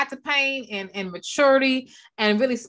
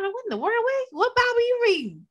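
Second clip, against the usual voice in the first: "What in the world?" much higher, in mock disbelief; 12 semitones higher than usual. Preacher mimicking a shocked listener, much higher. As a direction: imitate a shocked churchgoer, up high. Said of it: "some of these things y'all be hearing".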